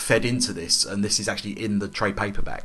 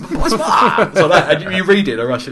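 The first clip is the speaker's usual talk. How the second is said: laughing; 8 semitones higher, 6 dB louder than usual. Real, not acted. Laughs at own Russian voice.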